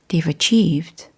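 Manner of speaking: ordinary talk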